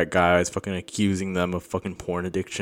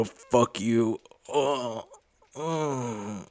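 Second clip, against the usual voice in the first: whiny breakdown; 5 semitones higher, 4 dB quieter, much slower than usual. Acted. Breaking down after the outburst.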